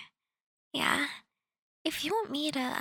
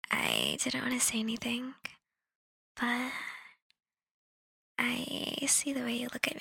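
whispering. Acted. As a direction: lean in and whisper it. Seductive confession begins.

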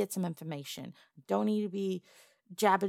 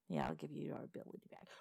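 fast, softer whispered aside; 3 semitones lower, 12 dB quieter, faster than usual. Acted. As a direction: whisper it quickly, dismissively. Aside to restore goblin's voice.

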